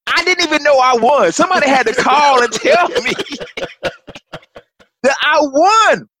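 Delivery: overwhelmed excitement. Real, not acted. Retelling hearing he won.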